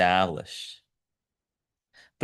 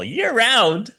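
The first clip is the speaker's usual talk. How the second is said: high, astonished mock outrage; incredulous disbelief; 13 semitones higher, slower than usual. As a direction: squeak out the shock at a year-round job. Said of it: "I was like".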